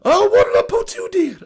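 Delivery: put-on high, loud falsetto old nanny; high old lady hello; Mrs Doubtfire voice. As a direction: greet sweetly in a cracking granny falsetto. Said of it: "I can't do it".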